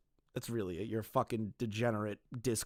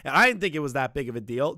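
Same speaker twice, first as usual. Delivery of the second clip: loud, higher hammed-up excuse; mock feigning; 5 semitones higher, 10 dB louder than usual. Streamer mocking a developer's mental health excuse, feigned. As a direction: imitate a fake plea, exaggerated and loud. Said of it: "like, the whole feigning, like".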